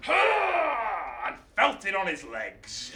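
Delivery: slow, loud, strained cry; straining under weight. Acted. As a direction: groan out under the load. Taking the weight of the sky.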